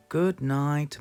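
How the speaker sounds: ordinary delivery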